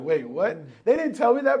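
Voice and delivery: high, loud disbelieving wait what; sudden high-pitched disbelief; shocked. Retold or imitated. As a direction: blurt it out in shock. Shocked reaction to sales rule.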